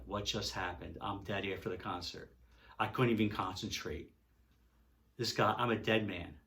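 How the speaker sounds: low, very quiet, stunned; stunned dread. Retold or imitated. Fan retelling his shock at a death threat, stunned and hushed. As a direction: drop to a small, low voice, as if doomed.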